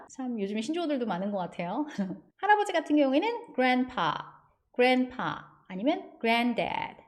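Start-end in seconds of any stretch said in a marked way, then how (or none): 3.5-7.0 slow careful grandpa and granddad. Shown as a demonstration. Teacher slowing for new words. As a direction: spell it out slowly for students. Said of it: "파 조금 길게".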